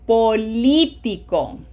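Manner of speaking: Político, slow, with a loud LÍ; stress on third-last syllable. Shown as a demonstration. Teacher letting students verify, slow Político stressed on LÍ. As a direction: draw it out, hitting LÍ in Político. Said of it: "on the third syllable. Third from the right".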